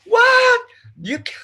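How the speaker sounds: high, loud comic protest; hammed-up shrieky objection; mock outrage. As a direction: squawk in exaggerated disbelief. Mock outrage at the faulty argument.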